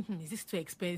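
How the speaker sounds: softly, doubtful, under the breath; quiet worried murmur. Retold or imitated. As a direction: murmur the price worry to yourself. Child quietly weighing the price.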